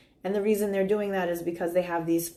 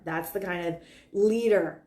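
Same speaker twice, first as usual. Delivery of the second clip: ordinary delivery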